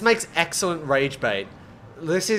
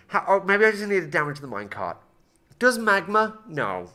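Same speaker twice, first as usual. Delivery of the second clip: normal talking voice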